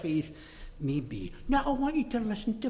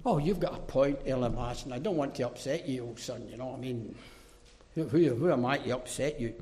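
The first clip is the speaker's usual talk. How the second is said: quiet, lower, cringing apology as a caricature; mock apologetic; 8 semitones lower, 6 dB quieter than usual. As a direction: make fun of a spineless preacher. Mocking an apologetic, compromising Paul.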